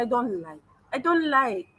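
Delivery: English with a Tanzanian lilt; Tanzanian accent. Shown as a demonstration. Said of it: "I speak with an accent".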